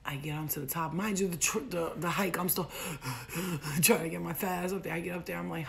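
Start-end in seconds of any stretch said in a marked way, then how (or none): 2.8-3.8 heavy acted gasps for breath; winded panting. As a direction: pant as if climbing a steep hike. Acts out being winded by hike.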